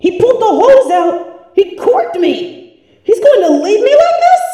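high, rising disbelieving cries; shocked outrage. Acted. Corked and left swollen.

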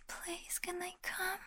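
demo plea. Shown as a demonstration. Showing partner what to say, soft pleading whisper. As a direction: model the begging line in a tiny pleading whisper. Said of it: "just say".

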